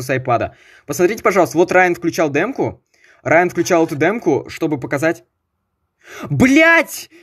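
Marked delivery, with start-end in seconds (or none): 6.3-7.1 raging gamer. Real, not acted. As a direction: yell it like a tilted gamer. Screenshot missed the video, outburst.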